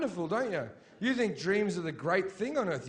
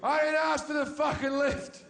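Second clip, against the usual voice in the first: indignant shouting through laughter; exasperated rant; 6 semitones higher than usual. Retold or imitated. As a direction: yell it back at her, half laughing. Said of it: "And it's like".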